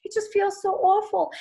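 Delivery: high, whiny fake sobbing voice; mock hysterical crying. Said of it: "hysterics where you start crying".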